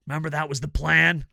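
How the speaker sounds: usual speaking voice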